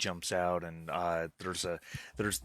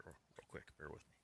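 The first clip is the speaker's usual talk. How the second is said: muttered aside; 4 semitones lower, 25 dB quieter, much faster than usual. Real, not acted. GM mutters while loading picture.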